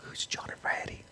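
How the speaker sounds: very soft, whispered aside; puzzled whisper. Retold or imitated. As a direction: whisper it as if to yourself. Puzzled aside on the phone call.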